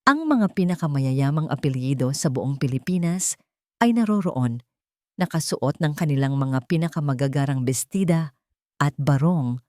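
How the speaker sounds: usual speaking voice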